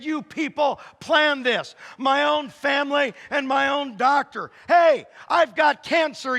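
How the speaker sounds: loud, high, indignant ranting shout; hammed-up shouting at family and doctor; mock outrage. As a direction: rant as if everyone betrayed you. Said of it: "And then I kinda lost it".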